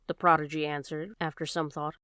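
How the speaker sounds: plain reading voice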